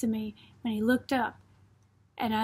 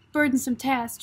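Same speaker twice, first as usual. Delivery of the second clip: plain reading voice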